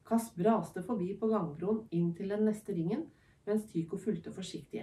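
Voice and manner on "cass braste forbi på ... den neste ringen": ordinary delivery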